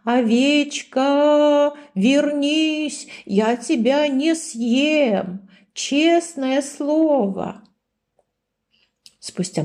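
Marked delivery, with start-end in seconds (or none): 0.0-7.6 high, slow, drawn-out call into the forest; the wolf calls the lost sheep through the forest. A narrator's reading. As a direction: call for her across the dark woods. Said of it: "кричал".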